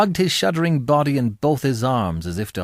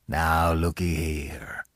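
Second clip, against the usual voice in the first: slow, low and soft, leaning in; low gruff convict; 9 semitones lower, 5 dB quieter, slower than usual. Acted. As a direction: draw the boy close with a growl. Convict lowers voice to boy.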